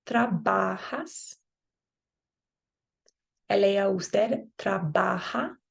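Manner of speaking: slow, careful Spanish "trabajo" with the j as a breathy h; Spanish model pronunciation. Shown as a demonstration. Teacher models conjugations slowly for students to repeat. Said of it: "if you wanna repeat after me".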